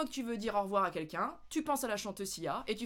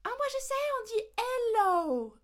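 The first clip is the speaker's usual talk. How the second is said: put-on much higher, slow eager child voice; eager know-it-all; 13 semitones higher, 5 dB louder than usual. A pupil proudly answering the teacher, eager and high. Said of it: "les gens vont dire".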